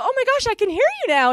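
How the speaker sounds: high, bright burst of relief; finally hears her friend, relieved; relieved exclamation. Retold or imitated. To the friend once the earphones go in, high relief. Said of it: "I go".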